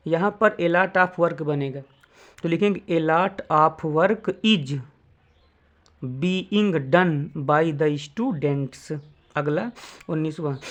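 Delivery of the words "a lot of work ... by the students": word by word, with gaps; slow dictation. Shown as a demonstration. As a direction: dictate slowly for students to write. Teacher dictates answer slowly. (धीरे-धीरे बोलकर लिखवाना)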